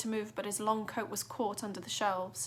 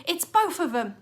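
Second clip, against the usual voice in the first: excited shout; 7 semitones higher, 9 dB louder than usual. A narrator's reading. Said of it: "another said".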